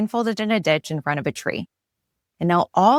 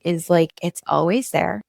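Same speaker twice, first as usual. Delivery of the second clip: usual speaking voice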